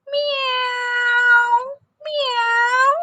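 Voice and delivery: squeaky high mewing kitten; high little meow sounds. Shown as a demonstration. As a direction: mew it like a tiny kitten. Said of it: "It goes like".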